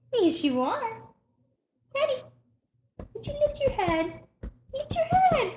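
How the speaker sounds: high cooing pet voice; baby talk. Acted. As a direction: coo at the puppy.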